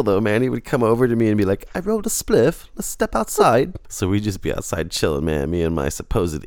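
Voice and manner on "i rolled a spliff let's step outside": imitating a British accent, pitched higher; breezy British invite, like a Liverpool lad. Mocking a fake Englishman inviting him out, in a British accent. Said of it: "spoke to me in a British accent".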